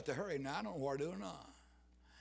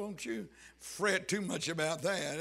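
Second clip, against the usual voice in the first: normal talking voice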